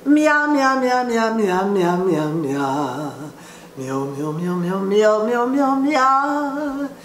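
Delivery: cat-meow vocal exercise; meow siren exercise. Shown as a demonstration. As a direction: sweep the range on meows. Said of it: "So I've been going".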